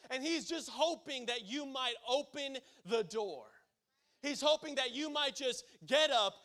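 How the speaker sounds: rising earnest preaching; preaching appeal. Acted. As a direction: plead with the congregation.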